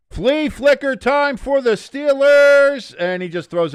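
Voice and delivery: high, loud, slow announcer hype; hyped announcer call. Acted. Streamer calling the Steelers play, hyped announcer. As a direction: sell the trick play like a big moment.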